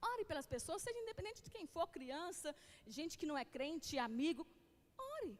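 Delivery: usual speaking voice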